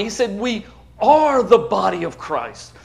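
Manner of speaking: slow and firm, leaning hard on "are"; "are" hit heavily, the rest measured; stress on are. Real, not acted. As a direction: drive home that we ARE the body. Contrasting like with are.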